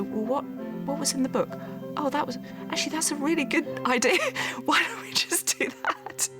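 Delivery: high, bright dawning idea; suddenly getting the idea, pitch rising; sudden realisation. Retold or imitated. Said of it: "because you just go".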